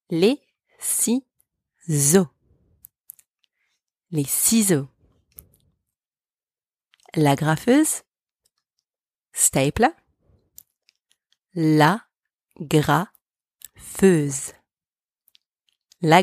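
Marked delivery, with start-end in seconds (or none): 0.1-2.3 Les ciseaux drawn out slowly; slow teaching model of Les ciseaux; slow syllable demo. Shown as a demonstration. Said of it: "Repeat after me". 11.5-14.5 La, pause, then graffeuse slowly; slow teaching model of La graffeuse; slow split demo. Shown as a demonstration. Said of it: "Repeat after me".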